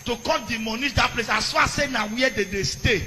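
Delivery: loud, high outraged sermon; fiery preaching. Acted. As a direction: rail at the governor from the pulpit.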